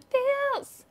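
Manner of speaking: very high, stretched word; mock emotional wail. Recapper on brothers hating themselves, high mock wail.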